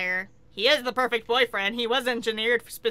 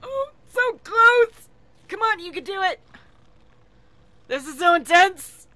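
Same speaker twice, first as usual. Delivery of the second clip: much higher, louder cheering on a near win; root for him as he almost makes it; excited cheering; 8 semitones higher, 6 dB louder than usual. Real, not acted. Cheering on a Sim in game.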